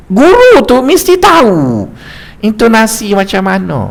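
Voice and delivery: usual speaking voice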